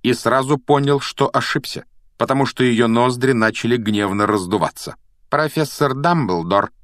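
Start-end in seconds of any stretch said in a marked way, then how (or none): 5.3-6.7 slow, higher, chilly statement; icy schoolmistress, measured pace; cold. A narrator's reading. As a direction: inform them coolly and deliberately. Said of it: "холодно произнесла профессор МакГонагал".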